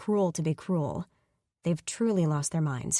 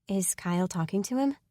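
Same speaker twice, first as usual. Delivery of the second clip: plain reading voice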